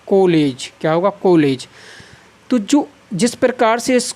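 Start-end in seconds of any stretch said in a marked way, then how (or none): 0.1-0.7 कॉलेज read as "koh-lej"; closed "o" in कॉलेज; o-vowel mispronunciation. Shown as a demonstration. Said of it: "यदि मैं इसका उच्चारण करूँ". 1.2-1.7 कॉलेज as "koh-lej"; long closed "o" in कॉलेज; o-vowel mispronunciation. Shown as a demonstration. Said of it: "यदि मैं इसका उच्चारण करूँ".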